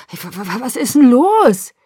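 stutter, then burst out high and loud; baffled, upset outburst; alarmed stammer. A narrator's reading. Father confronts daughter rushing out.

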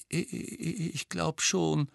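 put-on a scared little girl stutters; shaky. A narrator's reading. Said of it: "erwiderte sie zittrig".

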